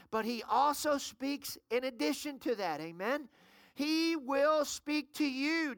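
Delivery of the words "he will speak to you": very slow, leaning on "will"; slow emphatic declaration. Shown as a demonstration. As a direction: draw out "He will speak to you" for the crowd to echo. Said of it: "Just shout out, say".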